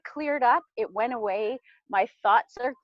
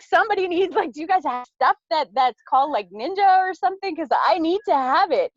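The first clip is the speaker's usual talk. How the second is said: high, loud, urgent begging; excited, pitched-up retold request; frantic plea; 5 semitones higher, 5 dB louder than usual. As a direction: beg friends on the phone in a rush. Retold frantic morning phone plea.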